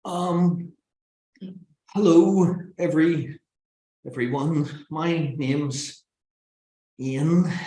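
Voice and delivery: stumbling start with long pauses; nervous, halting opening. Shown as a demonstration. Speaker showing a bad opening to an audience, halting and nervous. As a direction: hesitate painfully between the words. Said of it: "worst thing that an audience wants to hear".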